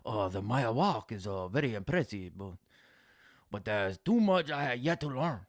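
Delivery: a hint of a dojo master quoting himself; mock wise master. A streamer mocking a chat line as a wise master, softly.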